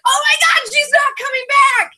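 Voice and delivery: high-pitched shrieked update; re-enacted screaming at the TV; retold excited scream. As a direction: shriek the latest gossip, hammed up. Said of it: "I'm screaming".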